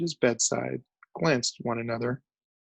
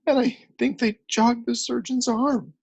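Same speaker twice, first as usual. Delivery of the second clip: much higher, tearful; crying again; 11 semitones higher than usual. A narrator's reading. Journalist crying again to the crew. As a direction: sob it out. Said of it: "He began to cry again".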